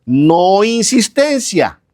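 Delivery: "no insistencia" spelled out slowly, each syllable weighed; slow down hard on "no insistencia"; deliberate emphasis on "insistencia"; slow stress. Shown as a demonstration. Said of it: "entre paréntesis puse".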